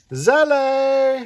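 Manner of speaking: Zele with an open, stressed zeh; said for a photo. Shown as a demonstration. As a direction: say Zele brightly, zeh-leh. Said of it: "You know what we say?".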